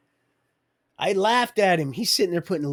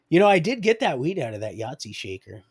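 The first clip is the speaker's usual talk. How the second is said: ordinary delivery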